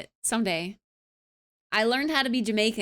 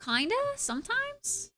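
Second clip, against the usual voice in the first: ordinary talk, nothing marked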